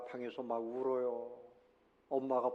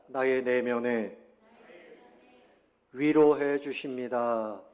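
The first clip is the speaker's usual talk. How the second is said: slow and louder, each word spaced for the congregation to echo; slow lead for repeating; 6 dB louder, slower than usual. Shown as a demonstration. Pastor leading the congregation in repeating, slow and louder. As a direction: spell it out slowly and loudly so all can follow. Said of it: "따라해 봅시다".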